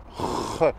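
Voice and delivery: "Re" as a voiceless scrape in the throat; jota gutturale. Shown as a demonstration. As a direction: rasp "Re" softly, as if about to spit. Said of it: "avec la gorge". (« Re » raclé dans la gorge, sans voix)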